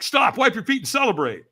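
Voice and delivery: loud, high urgent order; imitated helpers calling out; catchers' command. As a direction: halt them with excited urgency. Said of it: "And they're like".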